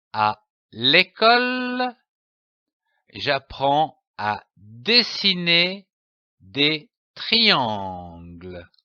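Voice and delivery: sounding out slowly, word by word; slow careful reading. Shown as a demonstration. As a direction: read it slowly like a beginner, stretching des triangles. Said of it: "Je lis une phrase".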